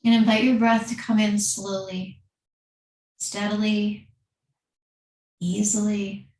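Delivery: calm, unhurried yoga teacher voice with long pauses; guided meditation. Acted. Leads seated breathing meditation.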